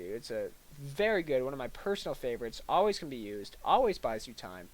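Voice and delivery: ordinary talk, nothing marked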